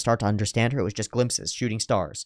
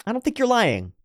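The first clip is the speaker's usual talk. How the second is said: voicing David; 12 semitones higher than usual. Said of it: "He says".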